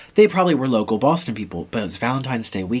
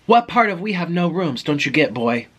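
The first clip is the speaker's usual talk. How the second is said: higher-pitched retold scolding; mock irate clerk; 6 semitones higher, 4 dB louder than usual. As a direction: imitate a clerk losing patience. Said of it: "cursing and screaming and telling me".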